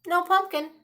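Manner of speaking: plain reading voice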